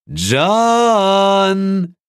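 shouted from downstairs; muffled distant shout. A narrator's reading. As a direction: call the name loudly from afar. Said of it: "dröhnte es im selben Moment gedämpft". (gedämpfter Ruf aus der Ferne)

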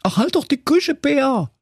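very high, sly wolf. A narrator's reading. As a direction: brush him off, sly. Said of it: "sagte der listige Wolf".

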